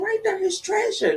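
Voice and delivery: put-on high little boy explaining; child voice. Boy tells mama where he saw Jonah, high child voice. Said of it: "He said".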